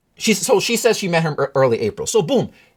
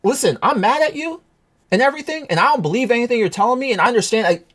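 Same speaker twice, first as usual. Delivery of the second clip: angry retold call; 7 semitones higher than usual. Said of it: "I'm telling her".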